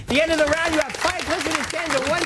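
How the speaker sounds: high booming show announcer. Acted. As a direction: sign off to the break like a host. Host announces commercial break.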